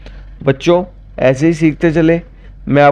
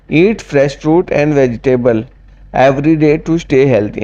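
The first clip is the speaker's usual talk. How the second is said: slow dictation; much slower than usual. Shown as a demonstration. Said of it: "teacher reads English slowly".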